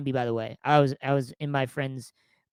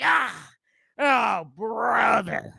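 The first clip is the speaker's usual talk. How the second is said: very slow, drawn-out "oh, brother" with a high "Ah" before it; treadmill guy groan; 7 semitones higher, 5 dB louder, much slower than usual. Retold or imitated. Imitating the gym guy as he hops off the treadmill, slow and high. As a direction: groan it out like a man about to fly off the belt. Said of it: "He's like".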